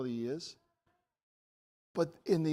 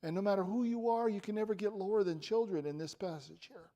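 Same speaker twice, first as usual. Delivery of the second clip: usual speaking voice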